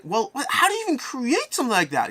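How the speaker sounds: high-pitched aghast question; mock horrified disbelief. Horrified contestant asking about the robot, high mock disbelief. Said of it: "backing off in horror".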